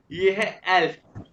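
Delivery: एल्फ said slowly and clearly, short e; slow teacher model of एल्फ; elf said slowly. Shown as a demonstration. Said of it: "ये देखो". (एल्फ धीरे और साफ़ बोलना)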